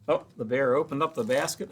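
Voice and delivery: plain reading voice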